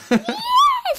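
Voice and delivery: high squeal of laughter. Real, not acted. Delighted at Ollie winning.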